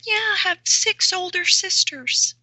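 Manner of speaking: imitated little voice, very high-pitched; Bill. Coach imitating a client answering about siblings, little high voice. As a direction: put on a small, high voice for a big man. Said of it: "kind of created this voice".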